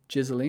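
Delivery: "Ghislaine" said as JIZ-uh-leen, questioning; unsure pronunciation. Real, not acted. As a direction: try "Ghislaine" with a J and a leen ending. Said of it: "It's Ghislaine".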